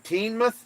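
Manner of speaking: Teignmouth as "EEN-muh", high and unsure; trying out Teignmouth. Shown as a demonstration. Checking with chat, he tries Teignmouth again. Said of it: "Is that right?".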